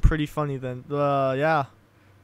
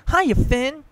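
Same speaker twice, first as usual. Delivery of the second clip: put-on high, loud, bubbly girl's greeting; cheerful Brooke; 10 semitones higher, 8 dB louder than usual. A narrator's reading. Brooke greeting Finn at night, high and cheerful. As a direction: chirp it out brightly and happily. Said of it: "Brooke says cheerfully".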